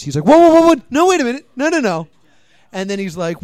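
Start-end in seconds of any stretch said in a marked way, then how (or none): 0.3-2.1 high, loud alarmed protest; comic frantic backpedal, easing off; mock panic. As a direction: stop them urgently, hammed up. Acts prisoner panicking at sentence.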